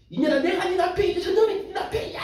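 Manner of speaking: high scolding yell, hammed-up; mock furious husband. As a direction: shout him down like a jealous husband. Husband yelling at unfaithful wife. (질투하는 남편의 과장된 고함)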